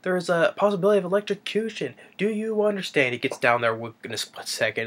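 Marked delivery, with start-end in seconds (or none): 0.0-3.1 much higher, fast, calling-down voice; shouted instruction from afar. Retold or imitated. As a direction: yell the warning down quickly. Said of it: "And he's like".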